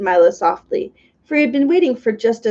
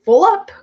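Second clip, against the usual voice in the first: high, louder grunt of a full man; 11 semitones higher, 6 dB louder than usual. A narrator's reading. Under Secretary grunting while reaching for cake. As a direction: grunt it, stuffed but greedy. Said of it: "grunted the Under Secretary".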